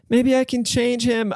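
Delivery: high mock girly voice, slowed; mocking the naive woman. Podcaster mocking the woman who keeps taking him back, high mock girly voice. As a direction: imitate her in a high, slow voice.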